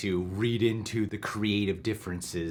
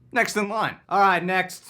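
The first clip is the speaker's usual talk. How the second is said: higher, bossy security guard; 7 semitones higher, 6 dB louder than usual. Acted. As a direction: wave the line through like a bored guard. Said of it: "I'll play Alfred".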